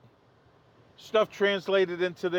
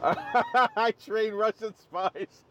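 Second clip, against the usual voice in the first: laughing through the words, higher pitched; 3 semitones higher, much slower than usual. Real, not acted. Host amused at having trained Russian spies, laughing. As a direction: crack up while getting the line out. Host cracks up at own joke.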